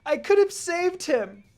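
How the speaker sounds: high, loud, slow anguished shout; grief-stricken yell. A narrator's reading. Lucy charging her own sensei, a high slow anguished yell. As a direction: hurl it at them slowly in grief. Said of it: "I continued".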